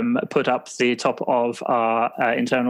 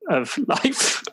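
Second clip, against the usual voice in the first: laughing; 10 semitones higher than usual. Real, not acted. Breaking into laughter.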